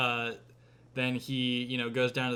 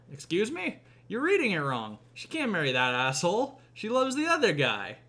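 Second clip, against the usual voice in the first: put-on higher, indignant kid protest; 6 semitones higher than usual. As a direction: play the outraged grandson. Kid objecting to the story.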